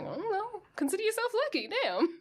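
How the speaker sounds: high-pitched, exaggerated exasperation; mock exasperated retort. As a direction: throw it out high, hammed-up. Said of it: "And he's like".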